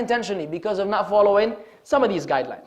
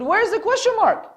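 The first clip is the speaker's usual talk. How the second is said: retold exasperated question; 8 semitones higher than usual. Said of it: "I said".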